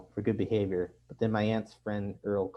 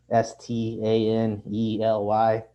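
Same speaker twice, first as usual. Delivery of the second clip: S T A N E L Y spelled slowly and louder, leaning on each vowel; emphatic spelling out; 5 dB louder, slower than usual. A narrator's reading. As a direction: spell out S T A N E L Y letter by letter, slow and loud. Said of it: "emphasis on each vowel".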